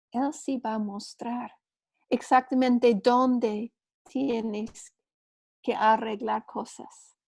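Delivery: normal talking voice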